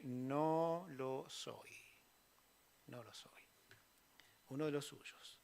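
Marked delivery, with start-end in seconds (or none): 0.0-1.7 very slow, dragged no lo soy; drawn-out denial. Acted. Pedro denies knowing Jesus. 2.9-3.4 much softer repeat of no lo soy; faded echo. Acted. Pedro repeats his denial.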